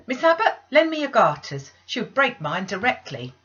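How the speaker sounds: loud high call to the other maid; urgent call. Acted. Bessie calls for garters.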